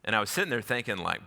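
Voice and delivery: usual speaking voice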